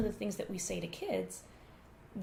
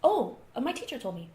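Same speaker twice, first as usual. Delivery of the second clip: put-on higher, breezy little-boy answer; sudden change; 5 semitones higher, 5 dB louder than usual. Son shrugging off his mother's question, breezy. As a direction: brush it off lightly as a child would. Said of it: "his demeanor changed very suddenly".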